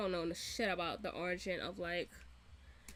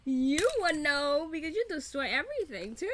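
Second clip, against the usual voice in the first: laughing retort; 6 semitones higher, 4 dB louder than usual. Retold or imitated. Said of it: "I was just like".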